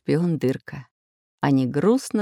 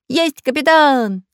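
high, loud, slow eager reply; joyful shout; 10 semitones higher, 6 dB louder, slower than usual. Acted. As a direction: cheer it back happily. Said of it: "радостно закричали".

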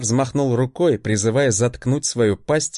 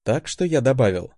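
usual speaking voice

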